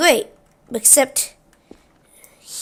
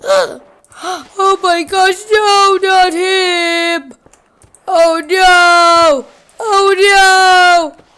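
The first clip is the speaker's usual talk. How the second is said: high, slow, then loud wailing oh no; drawn-out gasp turning into a dramatic wail; shocked cry; 7 semitones higher, 4 dB louder, much slower than usual. Retold or imitated. Everybody shocked at his death, high wailing cry.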